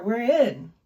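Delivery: loud triumphant announcement. Real, not acted. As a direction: proclaim you made it in. Triumphant entry into menu.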